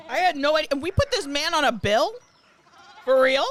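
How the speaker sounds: loud, incredulous outrage; loud disbelief. Real, not acted.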